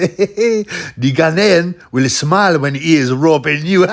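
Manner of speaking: put-on cackling West African accent; joke punchline. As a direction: crack up while telling the joke in a mock Ghanaian voice.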